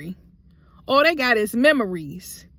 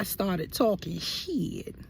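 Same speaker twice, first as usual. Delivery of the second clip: whisper; 3 semitones lower, 7 dB quieter, much slower than usual. Real, not acted. Aside after story of hitting.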